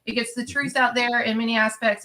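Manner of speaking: ordinary talk, nothing marked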